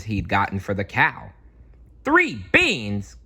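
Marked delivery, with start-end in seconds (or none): 2.0-3.2 high, louder outraged cry; cried out. Acted. Friends crying out at the silly trade. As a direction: throw up your hands at the beans. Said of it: "cried his friends".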